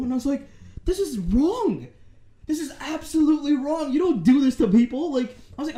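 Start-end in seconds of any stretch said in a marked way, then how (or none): 0.8-5.1 indignant. Retold or imitated. Retold confronting cheating girl.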